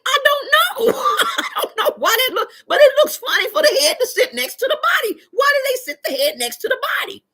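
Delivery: high, loud laughing shout; laughing mama. Retold or imitated. Streamer imitating her mother laughing at the video. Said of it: "She's like".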